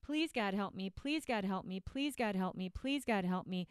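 rote, repetitive mock prayer; praying dieter. Dieter praying instead of acting, mock rote prayer. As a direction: chant the plea in an identical falling tune. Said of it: "I'm going to pray".

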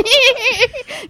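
high drawn-out squeal, imitated; retold stifled reaction. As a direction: mimic a long high "eee" from the back seat. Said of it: "in the back seat of the car going".

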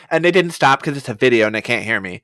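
usual speaking voice

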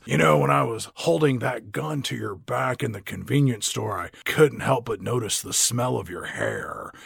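low, soft creepy line; a sleazy come-on, softly; low creepy Charlie Sheen. Pretend. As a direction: purr the creepy compliment low.

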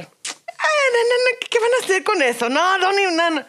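high, whiny protesting mom; objecting mom. Retold or imitated. Mom shooting down kids' idea.

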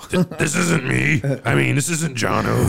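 slow, drawn-out fake voice; badly disguised voice. Caught caller denying it's him, slow put-on voice. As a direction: pretend to be someone else, unconvincingly.

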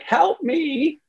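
high pleading cry; panicked part pleading. Retold or imitated. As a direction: beg for help in a high panicked voice. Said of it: "the part that's sympathetically hyperaroused".

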